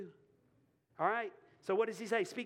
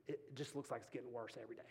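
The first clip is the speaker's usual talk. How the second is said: weary and low; 7 semitones lower, 12 dB quieter, faster than usual. Real, not acted. Confessing painful season.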